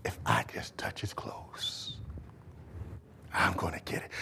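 whispered inner voice; saying within herself. Acted. Woman telling herself she'll be healed, whisper. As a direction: whisper it to yourself. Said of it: "she said in herself".

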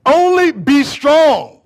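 loud, high, drawn-out scripture reading; emphatic preaching. Acted. Preacher declaring scripture to the congregation, emphatic preaching. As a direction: proclaim each word slowly and forcefully. Reads scripture at full force.

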